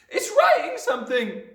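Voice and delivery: very high, loud excited shriek; screaming Nigel. A narrator's reading. Nigel watching the chalk write, high hysterical scream. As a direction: shriek the discovery to the room. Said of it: "screamed Nigel".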